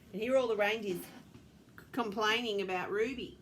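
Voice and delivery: ordinary delivery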